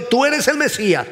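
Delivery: mild acted cry for mercy; retold shout. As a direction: half-shout it as the blind man pleading. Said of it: "Y gritaba".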